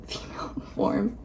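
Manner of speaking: soft giggly whisper; laughing whisper. Real, not acted. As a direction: breathe it out with a laugh. Repeats the phrase, laughing.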